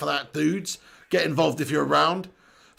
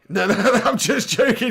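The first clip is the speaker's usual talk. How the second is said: usual speaking voice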